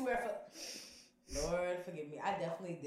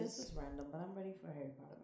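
quick breathless whisper; 2 semitones lower, 8 dB quieter, faster than usual. Real, not acted. Friend whispering a random confession before the punchline, hushed and fast. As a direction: rush it out under your breath.

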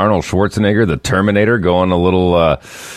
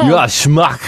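high-pitched Austrian accent impression; Arnold Schwarzenegger; 8 semitones higher, slower than usual. Radio host mimicking a star for a laughing co-host, Austrian accent. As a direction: throw out the insult in a hammed-up Austrian accent. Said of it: "He said it in a way cooler accent".

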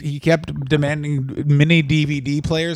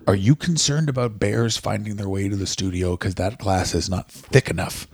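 lowered mock Vince voice, fast then dragging; gruff Vince impression; 6 semitones lower than usual. A lowered mock Vince McMahon voice addressing the Today Show host.

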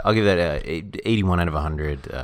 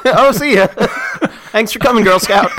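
high, loud laughing goodbye; 13 semitones higher, 9 dB louder than usual. Real, not acted. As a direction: laugh while waving them off. Laughing hangup of the bit.

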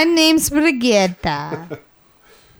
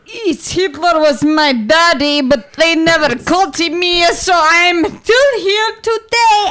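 mock-German rant rising to a high yell; hammed-up German accent, climbing in pitch; angry German accent; 3 semitones higher, 4 dB louder than usual. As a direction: play an angry German, building louder. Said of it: "sound very angry".

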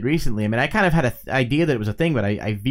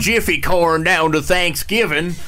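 mock Iowa accent; 4 semitones higher than usual. Co-host imagines Iowans bringing corn to Thanksgiving, mock Iowa accent. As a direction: ham up a folksy Iowa accent. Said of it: "is that how they speak in Iowa".

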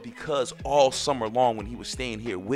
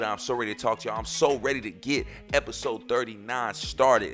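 usual speaking voice

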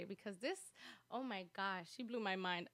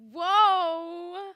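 loud, high, wailing amazement; stunned, hammed-up; 8 semitones higher, 14 dB louder than usual. Retelling being floored by cousin's remark, hammed-up amazement. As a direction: wail it as if mind-blown.

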